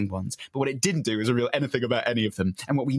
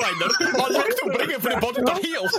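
Australian Borat; 12 semitones higher than usual. Acted. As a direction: ham up a Borat-style Australian accent. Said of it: "That's Australian Borat".

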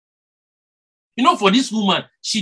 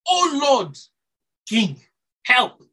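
a higher, louder cry for help; pleading cry; 7 semitones higher, 3 dB louder, slower than usual. Retold or imitated. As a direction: beg the king, a touch louder. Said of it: "She cried".

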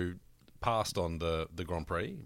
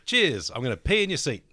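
cheeky loud reply; 6 semitones higher, 6 dB louder than usual. Retold or imitated. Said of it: "Jenson said".

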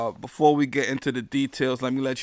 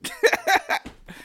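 high laugh. Real, not acted. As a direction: burst of high-pitched laughter. Laughs at his own joke.

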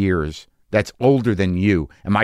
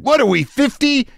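much higher hammed-up retort; mock angry yell. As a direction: fling the line at a mock shriek. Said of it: "I, I said".

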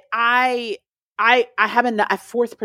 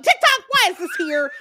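mock indignation; 11 semitones higher than usual. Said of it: "I'm like".

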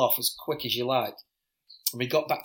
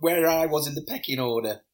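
normal talking voice

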